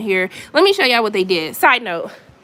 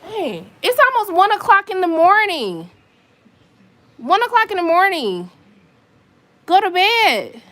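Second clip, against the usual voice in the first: raised, much higher voice, calling up to the neighbors; 8 semitones higher than usual. Real, not acted. To the noisy upstairs neighbor at one in the morning, calling out high and raised. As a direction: call up through the ceiling, fed up.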